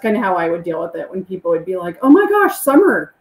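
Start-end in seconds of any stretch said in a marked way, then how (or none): 2.0-3.1 high, loud gushing greeting; squealing fan greeting her by name; mock excited fan. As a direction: gush like a starstruck fan.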